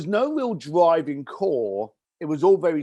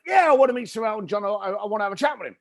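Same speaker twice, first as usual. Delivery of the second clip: high, fast, pushy caller; eager New Yorker; 7 semitones higher, much faster than usual. Retold or imitated. Caller insisting he wants to meet [name], eager. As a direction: rush it out like an eager fan.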